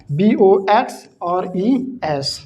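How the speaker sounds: slow letter-by-letter spelling; deliberate spelling for note-taking. Shown as a demonstration. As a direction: dictate each letter slowly. Teacher spells word letter by letter.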